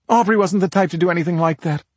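high, quick defensive reply; defensive sister. Acted. Sister defends the dead woman.

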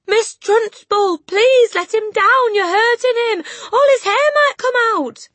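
high, loud, frantic plea; Miss Honey crying out. A narrator's reading. Teacher begging the headmistress to release a boy, frantic cry. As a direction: beg desperately at the top of a high voice. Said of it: "Miss Honey cried out".

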